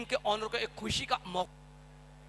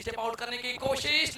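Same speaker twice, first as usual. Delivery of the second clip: normal talking voice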